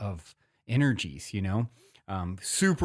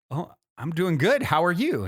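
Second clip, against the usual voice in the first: a quick, higher, louder startled hello back; surprised cheerful reply; 6 semitones higher, 4 dB louder than usual. Retold or imitated. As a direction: caught off guard, brightly answering a friendly stranger.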